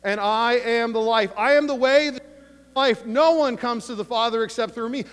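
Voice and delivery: full preacher voice for the scripture; fervent preaching. Acted. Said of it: "I'm preaching right now".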